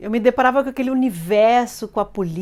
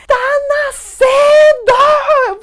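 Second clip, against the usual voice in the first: very high, slow, drawn-out yell; shout; 12 dB louder, much slower than usual. Retold or imitated. Mother in labour shouting to the nurse, high and drawn out. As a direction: shout it with the last strength. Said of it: "Eu falei".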